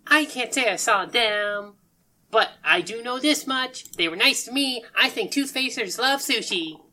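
high, lilting character voice; cheerful odd character. Acted. Sushi Kid telling travellers what he knows, high and cheerful.

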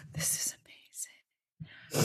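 breathless whisper of amazement; hushed gasp of wonder; whispered awe. Retold or imitated. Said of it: "And I'm like".

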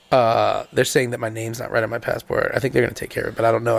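low, soft imitation of a controlled stressed man; Mike. Husband phoning from airport about ticket, low subdued imitation. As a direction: imitate him hiding stress in a deep, subdued voice. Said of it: "the way you talk".

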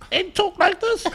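very high, loud chipmunk-like voice; tiny squeaky rapper. Retold or imitated. Musician mimics his four foot nine bandmates, squeaky voice. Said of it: "the two M Cs in the band".

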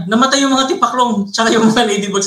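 imitating the classmate's upset grievance; angry classmate. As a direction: whine it like a wronged kid. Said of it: "galit na galit yung kaklase ko".

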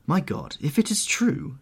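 high, shocked exclamation; exclaiming. A narrator's reading. Painter gazes at the portrait, exclaiming in horror. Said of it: "he exclaimed".

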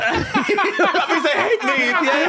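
very high, slow wail through laughter; playful high-pitched self-pity; laughing mock hurt. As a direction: squeal it slowly, half laughing. Joking self-pity amid laughter.

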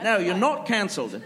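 Cockney-style British accent, hammed up; mock British reassurance; Michael Caine. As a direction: impersonate a famous British actor's accent. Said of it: "he's gonna talk like Michael Caine".

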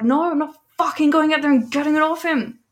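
high, loud indignant outburst; outraged refusal. Retold or imitated. Retelling her refusal to the receptionist, loud mock outrage. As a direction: refuse point-blank, voice shooting up.

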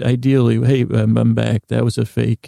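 usual speaking voice

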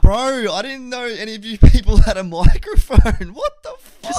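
loud, high, laughing in shock; embarrassed laughing shout. Real, not acted. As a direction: cry out through laughter, mortified. Realises the lobby heard everything.

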